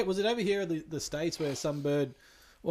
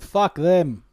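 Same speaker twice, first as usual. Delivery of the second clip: slow emphatic swear; loud; 2 semitones higher, 14 dB louder than usual. Real, not acted. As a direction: spit it out slowly and loud. Emphatic dismissal of bad cops.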